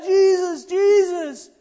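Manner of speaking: crying out. Retold or imitated. Beggar keeps shouting for Jesus despite the crowd. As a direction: yell his name over the crowd. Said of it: "He keeps crying out".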